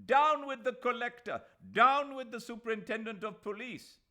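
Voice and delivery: high, loud protest chant yell. A narrator's reading. Mahmud Ali rousing the procession, yelled slogans. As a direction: bellow it at the rulers. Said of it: "every utterance had become a yell".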